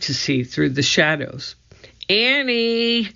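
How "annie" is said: mild shout. A narrator's reading. Jack searching for his sister in the shadows, mild shout. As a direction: call the name long and high. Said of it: "Jack shouted".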